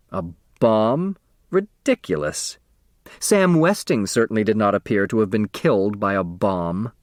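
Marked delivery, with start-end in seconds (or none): none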